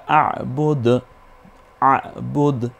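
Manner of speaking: আবুদ with the first আ squeezed in the throat and a bounced final দ; throat-pressed ayn. Shown as a demonstration. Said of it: "আইনটা গলায় চাপ দিয়ে".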